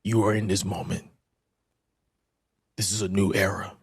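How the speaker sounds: lightly voiced Triple H, sinking to a whisper; Triple H impression. Host pulling Oba aside, a quiet hint of Triple H. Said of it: "the Triple H impression".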